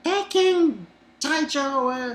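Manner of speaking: imp's plea. Retold or imitated. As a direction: call out to the captain slowly and pleadingly. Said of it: "and he's like".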